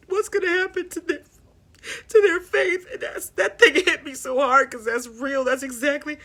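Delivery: holding back tears, then it breaks; tearful. Real, not acted. Moved to tears retelling funeral.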